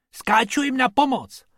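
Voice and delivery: high, eager shout; excited high-pitched announcement; enthusiastic calling. A narrator's reading. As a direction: call out gleefully, keen to help. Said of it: "volal Bobek nadšeně".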